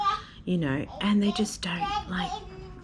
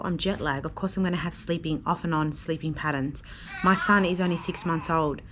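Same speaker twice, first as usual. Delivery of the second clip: ordinary talk, nothing marked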